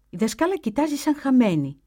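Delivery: normal talking voice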